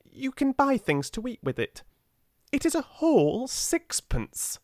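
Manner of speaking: put-on high little boy, firm and insistent; a small boy who won't take no; stout insistence. A narrator's reading. Little boy insists the poor girl takes his sixpence. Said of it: "he insisted stoutly".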